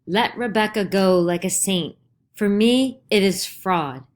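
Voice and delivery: loud angry call, rising on "For me"; calling angrily. A narrator's reading. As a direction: hurl it at her in anger. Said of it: "he calls angrily at her".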